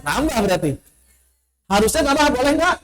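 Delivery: ordinary talk, nothing marked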